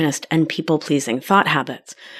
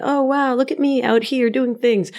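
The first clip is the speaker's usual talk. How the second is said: mock show-off; 7 semitones higher than usual. Mocking her former overachieving self.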